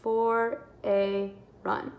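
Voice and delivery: very slow word-by-word "I went on a run"; teacher counting words in a sentence. Shown as a demonstration. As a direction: tap out each word slowly. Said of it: "I have my five spaces".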